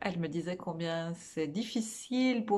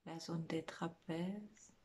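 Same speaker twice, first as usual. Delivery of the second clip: guided meditation; 4 dB quieter than usual. Real, not acted. Meditation teacher guiding listeners through a body scan, soft guided meditation. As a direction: lead them gently inward, phrase by phrase, leaving lots of space.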